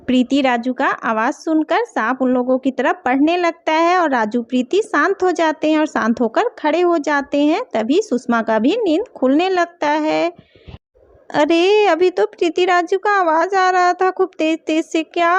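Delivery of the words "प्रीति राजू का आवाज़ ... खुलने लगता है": lagere, rustige vertelstem; verhalende vertelstem. A narrator's reading. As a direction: vertel kalm als verteller, los van de personages. Story narration between character voices.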